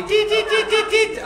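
very high, loud beeps; imitated alarm beeping. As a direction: mimic a store alarm going off. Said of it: "צפצפתי".

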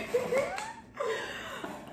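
very high, soft squeal of laughter; tearful laugh. Real, not acted. Reader moved by the love verse, tearful laugh. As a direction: squeak out a laugh close to crying. Overcome after reading the quote.

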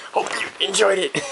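ordinary delivery

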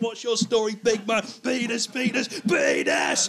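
high, hammed-up compere bellowing nonsense; mock shouty MC patter; shouting compere. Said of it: "compere's just gonna go on and shout about penises".

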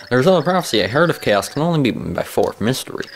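lilting dramatic verse reading; lightly hammed-up rhyming prophecy; prophecy recital. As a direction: intone it like a game's wise guardian. Reads the guardian's prophecy aloud.

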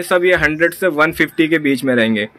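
usual speaking voice

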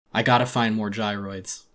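ordinary delivery, nothing marked

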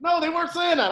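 loud, high, laughing protest; laughing denial. Retold or imitated. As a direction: blurt it out through laughter. Laughing through his own retold denial.

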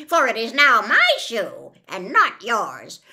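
loud, gloating wicked witch; witch's retort. A narrator's reading. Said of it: "retorted the witch".

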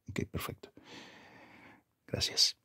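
hushed whispered thanks. Acted. Quietly thanking the clerk while signing papers, whisper. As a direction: keep it to a whisper.

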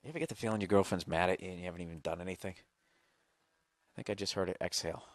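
low, soft confiding aside; hushed aside. Real, not acted. Host confiding that girlfriend seems mad, hushed aside. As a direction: drop the voice and confide in the listeners.

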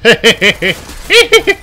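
high, loud burst of laughter; gleeful cackle. Real, not acted. Gamer about to hack everyone, gleeful cackle. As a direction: cackle out loud in a rising giggle.

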